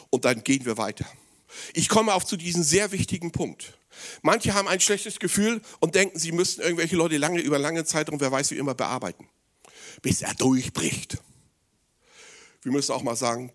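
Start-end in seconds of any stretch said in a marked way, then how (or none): none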